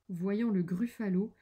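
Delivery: ordinary delivery, nothing marked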